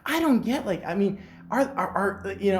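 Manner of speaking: high put-on woman's voice; a light lady impression fumbling for words; imitating the lady. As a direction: mimic her, way up in pitch and unsure. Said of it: "She's like".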